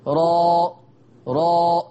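را with a full, thick ra and open a; heavy ra. Shown as a demonstration. Teacher leading students to repeat heavy ra. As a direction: say را heavy from the back of the tongue. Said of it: "قولوا كده معايا".

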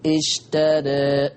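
اشترى said very slowly as eshtere, alif bent to e; slow اشترى with e vowels. Shown as a demonstration. Teacher contrasting the better second reading. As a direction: lean the alif of اشترى toward e. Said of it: "الصوت التاني أحسن".

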